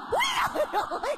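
high laughing shout of glee; high laughing exclamation; gleeful shout. Acted. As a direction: crow with delight, laughing. Wife exclaims at her own idea.

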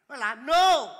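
bật cao giọng, quát thật to; nhấn chữ cuối bằng tiếng hô lớn; hô to minh họa. Acted. Diễn tả thế võ của ông nội. (hô lớn khoa trương)

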